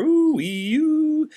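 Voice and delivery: high siren wail; ooh-wee-oo siren call. Acted. Siren again.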